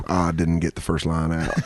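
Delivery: low, deflated imitation of defeat; retold glum admission, low; mock dejection. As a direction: drop the pitch and confess like a beaten man. Imitating comic dejected after failed audition.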